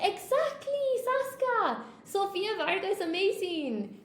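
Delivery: high-pitched, gushing enthusiasm; excited agreement. Real, not acted. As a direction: burst out in delighted agreement, high. Gushing agreement about a favourite actress.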